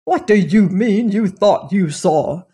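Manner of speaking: a sharp, impatient snap; snapped. A narrator's reading. Master snapping at a servant, sharp and impatient. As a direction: bite the question off at him. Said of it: "snapped Mister Saunderson".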